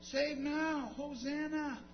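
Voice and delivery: high, softened mock cheer; high put-on crowd voice; crowd crying hosanna. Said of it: "the next day it was".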